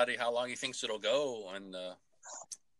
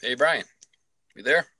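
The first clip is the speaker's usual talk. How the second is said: shouting into a phone; 4 semitones higher, faster than usual. Real, not acted. Caller checks if the line dropped.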